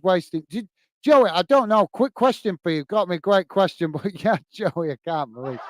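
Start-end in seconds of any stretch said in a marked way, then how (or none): 4.9-5.6 laughing. Real, not acted. Chuckling at Joey's season-ticket story, quiet laugh. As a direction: lower and softer, the words dissolving into laughter.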